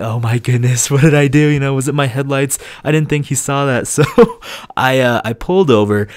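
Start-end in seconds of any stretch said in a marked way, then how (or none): 0.0-3.1 panicked. Retold or imitated. Pulled over by police, panicking.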